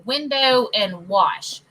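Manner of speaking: WINDOW with a full 'oh', WASH with no R, slowly; proper pronunciation. Shown as a demonstration. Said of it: "I've been proper on that".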